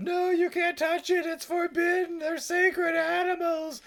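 very high, strained comic protest; mock yelling. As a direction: wail it in hammed-up dismay. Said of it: "like, yelling out".

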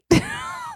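shrill high-pitched laugh burst; high squeal laugh. Real, not acted. Laugh after punchline.

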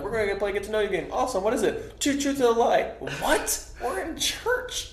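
bright, fast youth-leader pitch rising to shock; chirpy church youth leader, then aghast. Retold or imitated. Youth group mock dialogue.

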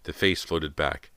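usual speaking voice

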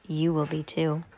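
low, soft menacing mutter; muttered aside. A narrator's reading. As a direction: mutter it under your breath to the children. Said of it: "Stefano muttered to the children".

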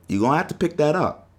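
normal talking voice

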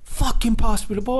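high, strained mock yell at a teammate; imitated angry yell. Teammate Bo yelling at Pin for the ball, high mock shout. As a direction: ham up a furious teammate demanding the ball. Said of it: "And he's like".

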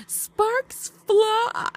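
high, drawn-out flourish on both words; theatrical premise reading. Shown as a demonstration. Host launching the movie premise, theatrical. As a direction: announce it sky-high with playful drama.

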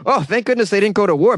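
usual speaking voice

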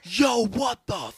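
hammed-up amazed shout. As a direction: gawk at the sky in disbelief.

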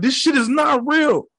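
high-pitched frantic disbelief; worked-up retold outburst; going crazy. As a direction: exclaim it way up high, losing it. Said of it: "I was going crazy".

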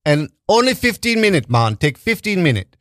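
amateur Jamaican impersonation; shitty Jamaican accent. Retold or imitated. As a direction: quote him in a bad Jamaican accent. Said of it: "I do a shitty Jamaican accent".

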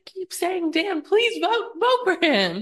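high, slow mock pleading; sing-song mock of the players begging; mocking the houseguests. Co-host mocks houseguests begging for votes, high mocking voice. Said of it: "And I'm like".

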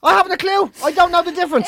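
loud, high disclaimer; high-pitched protest. Real, not acted. Pleading innocence of being racist.

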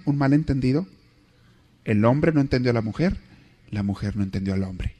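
usual speaking voice

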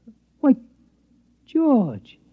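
as if you can't believe it's him; guard amazed to find George, surprised; surprised recognition. Acted. Guard surprised to see mouse.

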